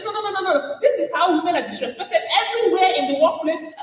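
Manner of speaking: high, laughing insistence; mock protest. Woman protesting disrespect, high and laughing. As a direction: play up the outrage.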